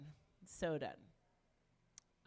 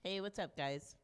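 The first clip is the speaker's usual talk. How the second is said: ordinary talk, nothing marked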